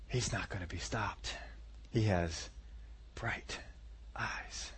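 very soft, low, fading to a whisper; hushed awe. Acted. Saul sees it in David's eyes, hushed awe. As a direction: sink into reverent near-whisper, word by word.

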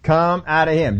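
slow, drawn-out order, said over; repeated command. Acted. Jesus kept saying it to him, slow repeated command. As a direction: repeat it slowly and deliberately. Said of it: "He kept saying to him".

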